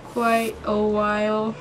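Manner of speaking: slowly reading out words while typing; slow dictation. Real, not acted. Girl slowly spelling out her text to her crush. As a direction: drag each word as you type it.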